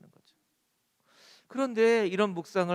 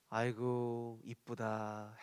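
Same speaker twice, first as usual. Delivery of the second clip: tender fond sigh; 9 semitones lower, 10 dB quieter, much slower than usual. Pretend. God's tender reply imagined.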